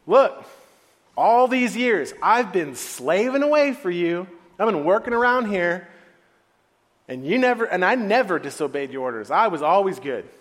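loud, indignant grievance; angry. Acted. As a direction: burst out with a long-stored complaint. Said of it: "and he was angry".

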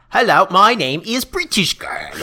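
hammed-up terrible British accent; badly faked British girl voice. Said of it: "a very shitty British accent".

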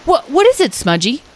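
put-on startled young character interrupting. A narrator's reading. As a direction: cut in, alert and curious. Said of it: "Lyle interrupted".

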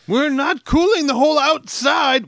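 loud, high, drawn-out dad yell; dad's mock shout. Dad scolding children about open windows, mock shouting. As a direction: bellow it slowly at the kids, pitched high.

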